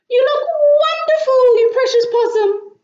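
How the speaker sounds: overjoyed doting grandma; delighted grandma. Acted. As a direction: gush over the little possum. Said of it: "said Grandma Poss".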